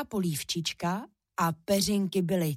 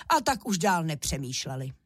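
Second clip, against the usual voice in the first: ordinary delivery, nothing marked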